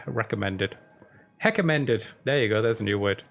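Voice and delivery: ordinary talk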